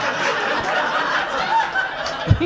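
squeaky silent cackle; high breathy laugh. Real, not acted. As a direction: let out a wheezing laugh. Laugh after punchline.

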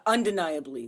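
ordinary talk, nothing marked

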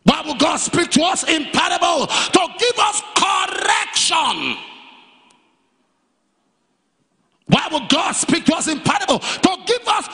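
high, shouted call, stretching the final words; full-force preaching. Real, not acted. Preacher telling the congregation why God speaks in parables, full-force preaching. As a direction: drive each answer home to the congregation.

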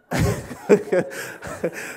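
helpless breathy chuckle; real wheezy giggle; laughing. Real, not acted. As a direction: break up laughing mid-story. Cracks up at audience member.

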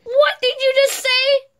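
high furious demand; outraged. Acted. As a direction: explode with disbelief. Outraged at the revealed kiss.

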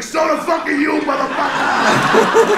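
high, loud acted comeback; angry retort. Boy to nun in a headlock, angry retort. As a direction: hurl it back, drawing out the last word. Yells back while squeezing her head.

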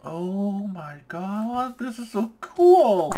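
slow, high, drawn-out amazement; long, swelling gush of awe; awestruck excitement. Real, not acted. Awed reaction while exploring.

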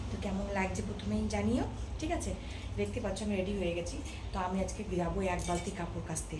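usual speaking voice

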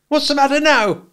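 high, loud and sharp sister's voice; smart, sharp demand. A narrator's reading. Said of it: "she said smartly".

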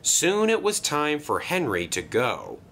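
ordinary delivery, nothing marked

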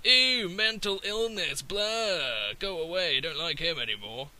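high sneering disgust, slow; a prissy bigot recoiling; mocking disgusted voice. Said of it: "there is a certain element of".

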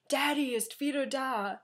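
Neil Patrick Harris in German. Retold or imitated. Vlogger imitates a TV character entering a bar, loud announcing impression. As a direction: burst in announcing yourself in German. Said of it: "can't really sound exactly like Neil Patrick Harris".

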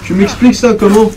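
loud, high indignant questions; demanding an explanation, incredulous; outraged disbelief. Real, not acted. Player demanding the game explain itself, loud indignant disbelief.

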